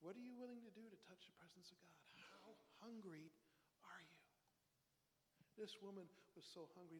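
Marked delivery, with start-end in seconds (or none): 0.7-4.2 whisper. Real, not acted. Intimate challenge to the congregation.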